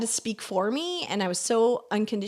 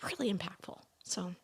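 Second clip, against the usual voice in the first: near-whispered, fighting tears; holding back tears; 12 dB quieter than usual. Real, not acted. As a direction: hold back the tears as you speak. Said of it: "trying not to cry".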